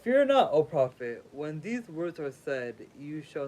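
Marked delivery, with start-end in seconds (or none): 0.0-1.2 loud proclamation. Acted. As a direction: cry it out like a god. Divine voice proclaiming to prophet.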